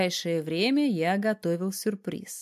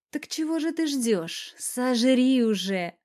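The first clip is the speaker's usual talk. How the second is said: high, teasing invitation, slowing down; impatient urging; 6 semitones higher, slower than usual. A narrator's reading. Wife eggs on amorous husband, impatient. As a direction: dare him in a high voice.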